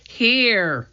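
high, drawn-out answer; long high call in reply; calling back. A narrator's reading. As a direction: call back so they can find you.

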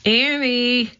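high drawn call. A narrator's reading. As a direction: call her name down the hall. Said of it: "he called".

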